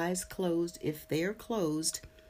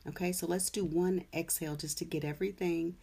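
guided meditation; 5 dB quieter than usual. Acted. Leads a meditation.

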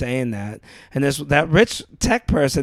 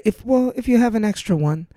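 higher-pitched, polite mock British accent; 7 semitones higher than usual. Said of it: "And it's more lovable".

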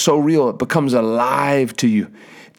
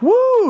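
joyful whoop. Retold or imitated. Healed man shouts again.

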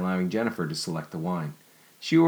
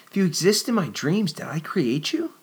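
wondering question; 11 semitones higher, 3 dB louder than usual. Acted. Jennifer wonders aloud to Nicholas.

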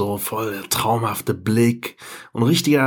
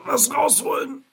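high, pressed voice like pushing something out; mock ad speaker, high and laughing; straining voice; 14 semitones higher than usual. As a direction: squeeze the words out in a strained high voice. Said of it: "Der hat so gesagt".

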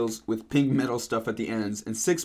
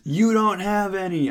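mad yell; 6 semitones higher, 4 dB louder than usual. Acted. Narrator contradicts Snape's other factors, mad yell. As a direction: yell it high, madly hammed-up. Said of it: "I yelled in madly".

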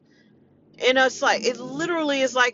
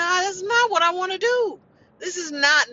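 breaking into tears; 7 semitones higher than usual. Real, not acted. Daughter caring for her dying mom, crying out. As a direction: voice cracking up high as she starts to cry.

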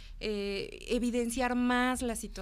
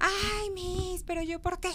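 high-pitched mock teenage protest; imitating a kid pleading unfairness; whining student; 8 semitones higher, slower than usual. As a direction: whine at the teacher, high and drawn-out. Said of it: "Y entonces empezaban".

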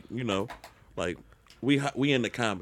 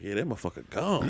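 softer, resigned admission; quiet deadpan; 3 semitones lower, 8 dB quieter than usual. Retold or imitated. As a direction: mutter it low and flat. Retold deadpan reply.

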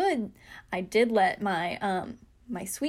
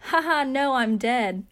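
louder, higher mock-cheery delivery of a dark joke; sarcastic joke; 4 semitones higher, 6 dB louder, slower than usual. To her worried suite mate, a flippant sarcastic joke. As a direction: toss it off like a flippant text. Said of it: "joke about the fact and just say".

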